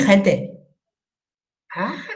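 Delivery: ordinary talk, nothing marked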